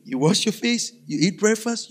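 ordinary talk, nothing marked

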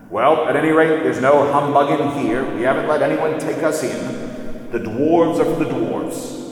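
loud, smug dwarf, last line slowed; gloating dwarves. A narrator's reading. Dwarves congratulating themselves after the fight, loud smug gloat. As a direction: crow over your own cunning.

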